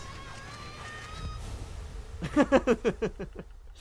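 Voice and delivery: high, drawn-out giggling fit; real laughter. Real, not acted. Streamer tickled by the Midwest naming, high giggles.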